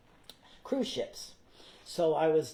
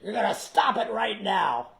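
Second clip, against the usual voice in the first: stage manager scolding; 3 semitones higher, 5 dB louder than usual. Retold or imitated. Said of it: "stage manager came in and goes".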